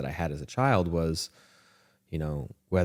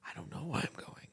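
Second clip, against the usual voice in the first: high quiet unsure; 11 semitones higher, 14 dB quieter than usual. Retold or imitated. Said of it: "And I was like".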